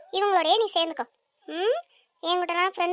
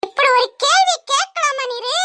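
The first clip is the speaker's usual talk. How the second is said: scolding; 5 semitones higher, 8 dB louder than usual. Acted. Wife scolds husband.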